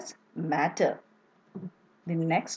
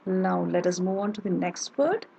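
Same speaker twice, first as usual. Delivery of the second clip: ordinary talk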